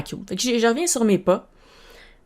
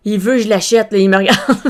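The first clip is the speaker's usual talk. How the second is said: ordinary delivery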